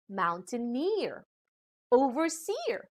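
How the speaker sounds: "mountaineer, overseer" with the weight on NEER and SEER; last-syllable stress. Shown as a demonstration. Teacher giving examples of ending ear, "mountaineer, overseer" stressed at the end. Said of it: "usually carry stress on the last syllable".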